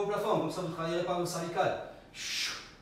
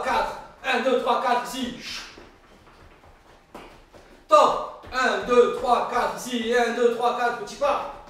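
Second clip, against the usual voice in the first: loud, punchy fitness-coach count; shouted counting; 2 semitones higher, 6 dB louder, slower than usual. Real, not acted. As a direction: call the counts out over the music.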